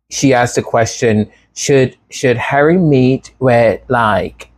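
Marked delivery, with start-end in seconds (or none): none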